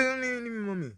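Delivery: put-on high, pleading little boy's protest; child pleading. Little boy pleading with his mother not to be sent away, high and pleading. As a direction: beg like a small child refusing.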